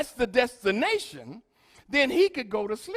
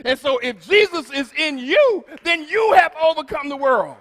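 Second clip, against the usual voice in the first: rising, louder preacher's call; preaching climax; 4 semitones higher, 6 dB louder than usual. Real, not acted. Preacher telling the church they have overcome, loudly. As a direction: drive the point home to the congregation.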